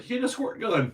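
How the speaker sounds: high, loud mocking cop voice; mock excuse. Mocking the cop who shot a kid, mock excuse. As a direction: imitate a panicked excuse, hammed-up.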